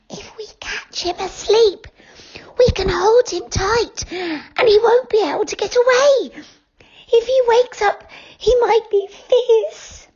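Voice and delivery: put-on high, whispered girl's warning; a girl plotting in a hush; Goldilocks whispering. A narrator's reading. Goldilocks voiced in story.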